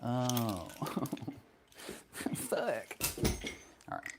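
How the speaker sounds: ordinary talk, nothing marked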